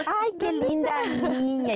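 put-on high, slow, sugary voice to a little girl; sweet talking to a child. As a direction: coo at a stranger's child. Mother pretending not to know daughter.

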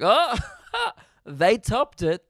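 high, slow mock congratulations; sarcastic. Mocking TikTok users, slow sarcastic praise. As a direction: drip sarcasm on each word. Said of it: "I'm like".

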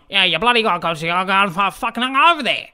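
half-swallowed ocker slurring; imitating a broad Aussie working bloke; mumbled broad Aussie bloke. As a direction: mumble it like an uncle you can't understand. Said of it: "but he's going".

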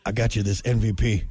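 low, lazy Nicolas Cage impression; fake Nicolas Cage. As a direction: mumble it like Nicolas Cage in Con Air. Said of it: "That fake Nicolas Cage accent".

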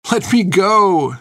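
high, drawn-out desperate plea; anguished slow cry; begging. A narrator's reading. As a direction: beg to be released, stretching the words. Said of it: "she begged".

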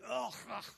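disgusted ugh. Retold or imitated. People taste bitter water.

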